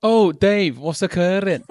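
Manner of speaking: Welsh accent impression. Shown as a demonstration. Guest showing off his only accent impression, in put-on Welsh. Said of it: "I can do an impression of".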